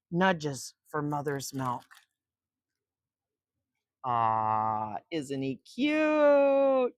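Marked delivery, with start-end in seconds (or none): none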